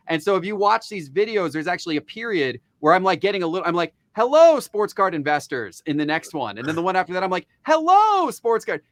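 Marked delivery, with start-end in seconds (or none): 4.2-5.8 "Hello" stretched and leaned on, a bit louder; more emphasis. Retold or imitated. To his audience, an intro said with more emphasis. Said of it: "I'll actually say it with more emphasis". 7.6-8.8 "Hello" leaps much higher and louder, then "sports card" falls; more animated. Retold or imitated. To his viewers, an over-the-top animated intro. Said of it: "I get a little more animated with it".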